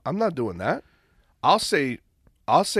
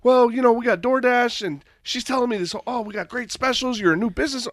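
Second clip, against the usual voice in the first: imitating sales rep; 8 semitones higher than usual.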